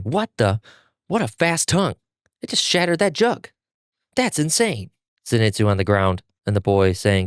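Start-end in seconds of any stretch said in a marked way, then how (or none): 0.0-4.9 mock screaming. A narrator's reading. Zenitsu shocked by the demon's tongue, mock screaming. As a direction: pitch it up in half-screamed alarm. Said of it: "Zenitsu screaming".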